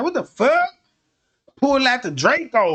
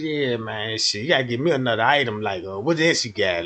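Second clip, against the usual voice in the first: put-on much lower kid voice haggling; low gruff haggler; 11 semitones lower, 4 dB quieter than usual. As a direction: growl out the counteroffer low.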